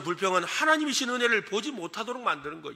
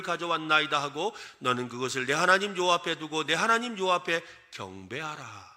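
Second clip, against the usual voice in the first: scripture reading; 5 semitones lower than usual. Acted. Preacher reading the passage to the congregation, solemn scripture reading. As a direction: read it solemnly, on a level low pitch.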